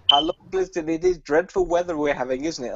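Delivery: imitating a caller's accent; a hint of an Indian phone agent making small talk; mock call-centre accent. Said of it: "he said to me".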